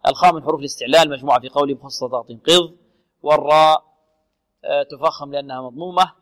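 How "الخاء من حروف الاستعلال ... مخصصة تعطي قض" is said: normal talking voice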